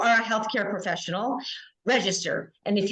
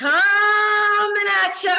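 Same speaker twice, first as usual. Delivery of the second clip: huge, high, slow silly shout; repeating louder and sillier; 12 semitones higher, 7 dB louder, much slower than usual. Shown as a demonstration. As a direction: bellow it like a hammy radio host. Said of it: "It gets louder each time".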